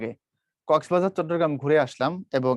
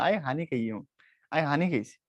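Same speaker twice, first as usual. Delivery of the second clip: soft Noakhali accent with হানি for পানি; a quiet Noakhali dialect demo; 3 semitones lower, 7 dB quieter than usual. Shown as a demonstration. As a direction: mumble it like a Noakhali local. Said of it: "নোয়াখালীরটা বলেন".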